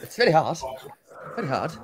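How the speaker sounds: lightly put-on posh South African accent; a demo of posh South African speech. Shown as a demonstration. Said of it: "This is a posh South African".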